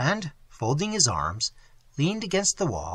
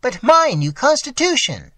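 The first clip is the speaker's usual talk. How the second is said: much higher, louder cry; loud exclamation; 10 semitones higher, 7 dB louder than usual. A narrator's reading. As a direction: blurt it out over the crowd. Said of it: "exclaimed a fifth".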